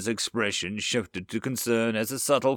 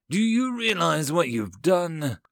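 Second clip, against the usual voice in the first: higher, slow, exasperated demand; 6 semitones higher, 3 dB louder than usual. A narrator's reading. Alien counselor confronting the human chancellor, exasperated. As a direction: confront them, drawing the words out.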